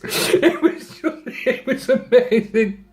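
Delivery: high, slow, helpless laughter through words; laughing. Real, not acted. Storyteller recalling the taxi fight, laughing. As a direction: crack up while speaking. Storyteller cracks up at his memory.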